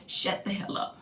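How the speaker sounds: hushed blunt order. Retold or imitated. Retelling blunt advice.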